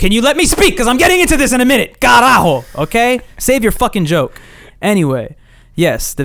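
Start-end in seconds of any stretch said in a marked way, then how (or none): 0.0-4.4 high, loud scolding; exasperated outburst. Pretend. Host cutting off a joking co-host, loudly exasperated. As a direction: shout down the interrupter, playfully fed up.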